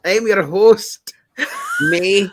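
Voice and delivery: a host greeting the show in a hammed-up Scottish accent. Host welcomes listeners in a mock Scottish accent. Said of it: "How did you learn to do a Scottish accent?".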